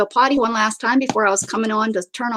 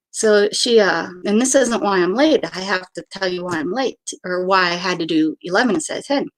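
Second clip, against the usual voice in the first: ordinary talk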